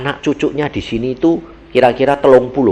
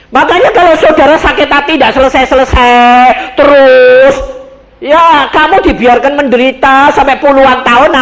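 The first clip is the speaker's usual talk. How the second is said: loud, raised preaching push on "rugi"; full-force sermon, higher and louder; fiery preaching; 12 semitones higher, 8 dB louder than usual. Acted. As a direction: drive it home to the congregation. Preaching climax to congregation.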